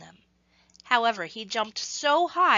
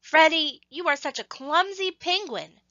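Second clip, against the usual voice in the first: teasing penguins; 7 semitones higher, 4 dB louder than usual. Acted. Penguins tease clumsy Freddy third time.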